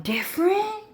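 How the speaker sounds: high-pitched incredulous question; mock European. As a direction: squeal the word in mock disbelief. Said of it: "now they're all like".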